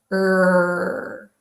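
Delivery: a held Er with the tongue pulled back; R sound demo. Shown as a demonstration. Teacher modelling the R sound for students. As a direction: sustain Er, lips rounded. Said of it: "to make the R sound".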